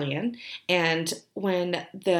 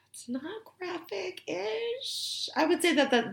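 sighed, drawn-out "graphic-ish", high and much softer; hesitant hedge; 13 semitones higher, 4 dB quieter than usual. Real, not acted. Admitting a scene is borderline, sighing and hedging. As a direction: stretch "graphic-ish" slowly, unsure how to put it.

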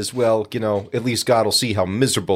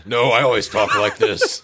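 mock deep voice. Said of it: "this is how I now talk".

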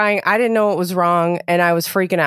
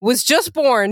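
raised pitch, fired-up disbelief; pitched-up exasperated tirade; indignant rant; 6 semitones higher than usual. Real, not acted. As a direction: vent about the clueless friend. Ranting about friend's advice.

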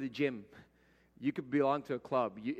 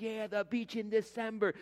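higher, breezy mock tourist cheer; mock crowd voice; 7 semitones higher than usual. Pastor mimicking the congregation about the December beach, higher breezy voice. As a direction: toss it off like a carefree visitor. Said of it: "And you guys are like".

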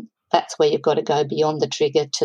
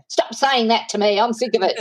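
higher, fed-up mock snap; a hint of an irritated wife; mock exasperated outburst; 6 semitones higher than usual. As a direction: play the wife who's finally had enough, half-joking. Wife fed up years later.

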